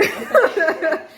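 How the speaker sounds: laughing. Real, not acted. Laughing at her own insult.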